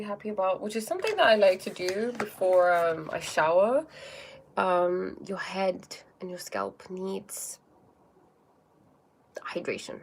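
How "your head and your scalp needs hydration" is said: slow, soft whisper. Real, not acted. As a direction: whisper it like a beauty tip. Soft hair-care aside.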